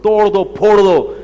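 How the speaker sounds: hammed-up "smash it, break it" sarcasm; mock reckless advice. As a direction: egg him on with exaggerated scorn.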